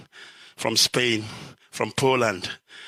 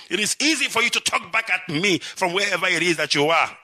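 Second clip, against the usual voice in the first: usual speaking voice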